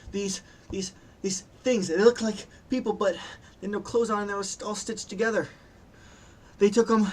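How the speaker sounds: high, distressed, halting then tumbling out; frightened survivor gasping out the story; trying to calm down. Acted. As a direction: plead in a high, upset voice. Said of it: "she's trying to calm down".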